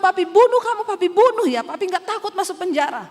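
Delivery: loud, high furious threat on bunuh; enraged father threatening his daughter; father's death threat. Retold or imitated. Father threatens to kill her.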